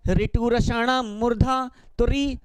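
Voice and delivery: ordinary talk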